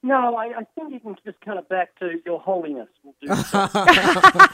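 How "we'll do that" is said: high, slow words through laughter; giggling at his own joke; laughing. Real, not acted. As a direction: laugh while joking along. Guest joking about titles.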